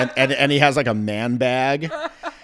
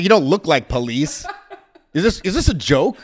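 higher, louder, laughing through it; laughing disbelief; 5 semitones higher, 7 dB louder than usual. Retold or imitated. To undercover cops, laughing loud disbelief. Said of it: "I'm like".

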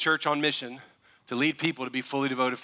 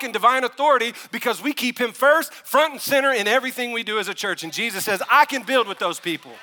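loud, high, driving sermon voice; rising fervor of a pastor stirring his church; preaching at full force; 8 semitones higher, 7 dB louder than usual. Real, not acted. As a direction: preach it hard to the room. Preaching climax to congregation.

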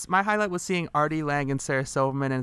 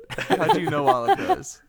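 high laughing disbelief; 14 semitones higher, 5 dB louder, slower than usual. Real, not acted. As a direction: laugh out the question. Laughing surprise.

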